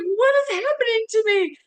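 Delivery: high, loud, overwhelmed cry of disbelief; bewildered outburst. Retold or imitated. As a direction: blurt it out, caught off guard by emotion. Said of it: "And I was like".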